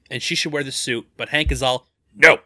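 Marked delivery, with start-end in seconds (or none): 2.2-2.4 gruff flat no, yelled; Michael Douglas impression. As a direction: bark a stern old-man no. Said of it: "The good Michael Douglas impression there".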